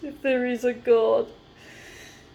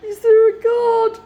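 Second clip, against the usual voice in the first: much higher and louder, crying out; anguished shout; 10 semitones higher, 8 dB louder than usual. Real, not acted. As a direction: hurl the question at the sky. Repeats question, quiet then shouted.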